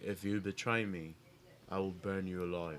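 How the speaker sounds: low, soft queenly threat; a quiet menacing queen's vow; Daenerys impression. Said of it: "That's a great, great Daenerys Targaryen, uh, impression".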